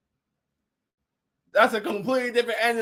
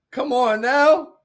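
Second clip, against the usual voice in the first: slow drawn-out; 5 semitones higher, 5 dB louder, much slower than usual. Retold or imitated. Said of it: "Big Ty Asking Hand said".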